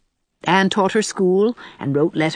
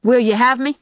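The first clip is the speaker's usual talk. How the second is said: lightly voiced rustic suitor, higher pitch; country suitor; 6 semitones higher, 3 dB louder than usual. A narrator's reading. Sam proposes out of the blue to Anne, bluntly. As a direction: pop the question, rising at the end.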